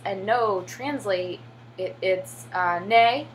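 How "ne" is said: "Ne", short and clear as in Korean; Korean word. Shown as a demonstration. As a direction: say "Ne" like the Korean yes. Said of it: "is yes".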